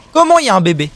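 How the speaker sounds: loud, high disbelieving question; startled outcry. Real, not acted. Player finding a baby enemy, shocked loud exclamation. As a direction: burst out in surprise.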